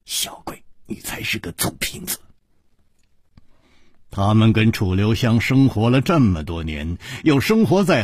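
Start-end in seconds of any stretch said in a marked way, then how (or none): 0.0-2.2 hushed, whispered retort; small voice, lip bitten. A narrator's reading. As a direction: whisper the teasing scold very softly. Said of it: "咬着嘴唇小声地说". (咬着嘴唇小声嗔骂)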